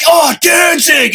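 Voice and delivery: high, slow fan shout; excited call-out. Retold or imitated. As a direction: call out to your idol across the aisle. Fan calling out to [name].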